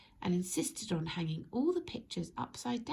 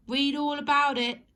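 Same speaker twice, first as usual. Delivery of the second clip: loud, higher street-seller cry, words in right order; newspaper seller shout; 7 semitones higher, 9 dB louder than usual. Acted. Paperboy hawking the news properly, shouted. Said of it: "instead of shouting".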